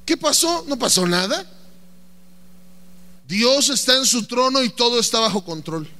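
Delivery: ordinary delivery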